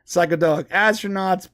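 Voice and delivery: ordinary delivery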